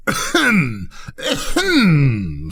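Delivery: high drawn-out falling ahem; irritated throat-clearing to cut in; angry "ahem". A narrator's reading. Rocket cannot get a word in, annoyed ahem. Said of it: "said the Rocket angrily".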